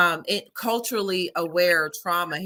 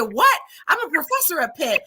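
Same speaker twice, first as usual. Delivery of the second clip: high, excited disbelief; raised pitch, thrilled and quick; amazed quote; 8 semitones higher than usual. Retold or imitated. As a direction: re-enact blurting out a coincidence. Retelling her amazed reply.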